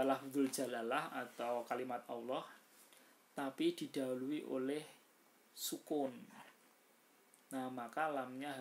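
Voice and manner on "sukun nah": usual speaking voice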